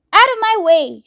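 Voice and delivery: high bossy yell; shouting. A narrator's reading. Grandma Georgina shouting as she blows past. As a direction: shove them aside with your voice. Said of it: "shouted Grandma Georgina".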